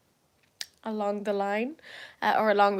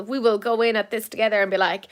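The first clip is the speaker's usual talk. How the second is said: ordinary delivery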